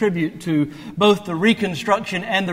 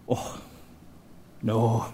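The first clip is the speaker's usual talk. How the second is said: bad Scottish accent; 5 semitones lower, 3 dB quieter, slower than usual. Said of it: "do a Scottish accent".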